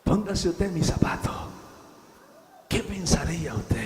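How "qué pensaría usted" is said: ordinary talk, nothing marked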